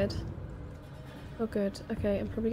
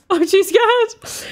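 much higher, louder and slow, "I'm too scared" wailed out; terrified wail; 10 semitones higher, 15 dB louder, much slower than usual. Real, not acted. Player dreading the next room, drawn-out terrified wail. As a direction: stretch "scared" into a frightened moan. Frightened player repeats phrase.

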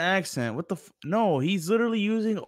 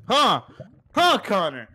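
raised, louder challenging question; mock confrontation; 4 semitones higher, 5 dB louder, slower than usual. Real, not acted. Friend challenging Connor over the app, loud needling. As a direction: jab the question at him, loud and high.

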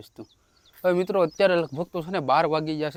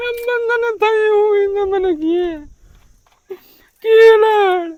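whiny child's voice, much higher, mock sobbing at the end; mock crying child; 9 dB louder, slower than usual. Father teasingly mimicking his crying daughter's whine. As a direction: imitate the little girl's crying, hammed up.

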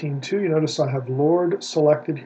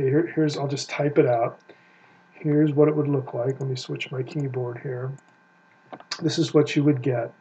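normal talking voice

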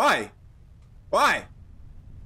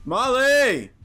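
calling out; 7 semitones higher, 4 dB louder than usual. Real, not acted. Calling lost dog.

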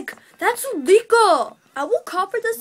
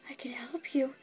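softer, unvoiced whisper of I can help you; 4 semitones lower, 14 dB quieter than usual. Pony offering help to the clerk, whisper. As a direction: murmur the offer under the breath.

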